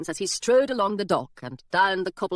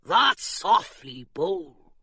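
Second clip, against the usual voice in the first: pirate musing; much slower than usual. A narrator's reading. Pirate remark.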